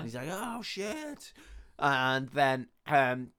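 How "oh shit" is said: high, soft "uh-oh" voice; retold panic, kept small; mock dismay. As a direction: squeak it quietly, as a doomed vampire.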